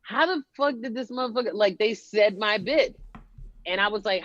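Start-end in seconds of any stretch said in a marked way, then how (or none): 0.0-1.5 fast, high incredulous outburst; stunned inner voice, rushed; shocked disbelief. Retold or imitated. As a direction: blurt it out in disbelief. Retold inner reaction, shocked.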